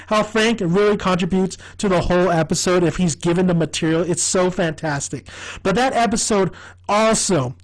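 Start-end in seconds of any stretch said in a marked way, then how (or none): none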